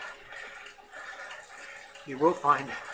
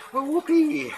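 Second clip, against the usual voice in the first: sarcastic whoopee; 13 semitones higher, 8 dB louder, slower than usual. Real, not acted. Sarcastic cheer at workout's end.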